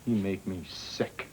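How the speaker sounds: low, slow contempt; cold, quiet disgust. Acted. Son disgusted with father.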